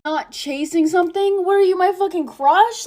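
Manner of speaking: high-pitched, incredulous echo; incredulous scoff. Acted. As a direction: throw it back in disbelief. Scoffs back at quoted message.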